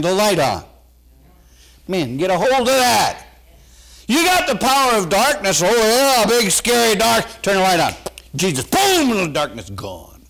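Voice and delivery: loud, high excited preaching. Acted. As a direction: shout it out to the congregation. Preaching at full force.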